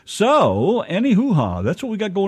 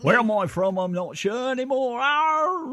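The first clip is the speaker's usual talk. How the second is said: high, put-on Cockney, climbing to a yowl; mock Cockney; 11 semitones higher than usual. Teased host hamming up confusion in high mock Cockney. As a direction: ham up a confused Cockney, ever higher. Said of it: "starting to sound like a Cockney".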